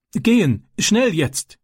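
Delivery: high, trembling and urgent. Acted. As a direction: blurt it out high and shaking. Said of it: "antwortete er zitternd". (zitternd und gehetzt)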